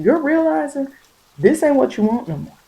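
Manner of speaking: normal talking voice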